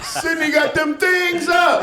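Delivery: high, drawn-out holler; mock holler. Comedian cuts across his friends about Sydney, high mock holler. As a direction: holler it high and slow, hammed up.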